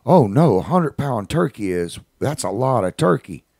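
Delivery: acted child, emphatic and high; little kid. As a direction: imitate a small boy protesting, swooping up in pitch. Voices little kid answering him.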